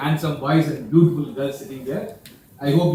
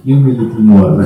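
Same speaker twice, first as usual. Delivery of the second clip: loud, low mock Russian accent; 7 semitones lower, 8 dB louder than usual. Vodka drinker wants more, in loud Russian accent. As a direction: boom it out in a put-on Russian accent. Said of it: "here in Russia".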